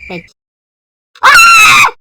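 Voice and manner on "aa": very high, loud shriek; death scream. Real, not acted. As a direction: scream as you get killed. Killed in game. (tiz bir çığlık)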